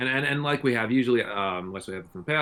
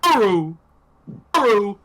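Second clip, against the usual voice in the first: high, loud drawn-out chant-like yell; hyped-up pronunciation; 14 semitones higher, 9 dB louder, much slower than usual. Shown as a demonstration. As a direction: belt out each word like a long-awaited battle cry. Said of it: "I'm gonna do the, the, the pronunciation".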